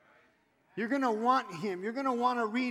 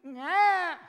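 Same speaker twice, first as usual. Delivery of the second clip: high, loud imitated child's wail; sudden loud kid's yelp; child's cry; 9 semitones higher, 8 dB louder than usual. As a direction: mimic a kid crying out for mother. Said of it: "And I hear this".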